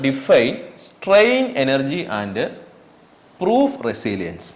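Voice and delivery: drawn-out words read for copying; slow dictation. Shown as a demonstration. Teacher reading exam question to students, slow dictation. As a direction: read the question slowly for notes. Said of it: "dictating exam question".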